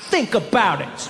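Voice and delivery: put-on haughty child making mom wait; kid's attitude. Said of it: "give my mom unlimited attitude".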